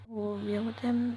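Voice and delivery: ordinary delivery, nothing marked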